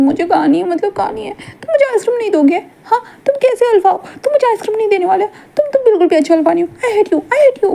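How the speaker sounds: high, half-laughing tantrum voice; whiny childish Wing. Acted. Wing whining at Wangji for ice cream, high childish tantrum. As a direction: stamp your foot like a spoilt child.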